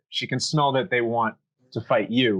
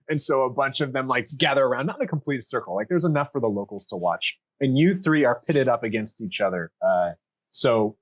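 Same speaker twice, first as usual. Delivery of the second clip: ordinary talk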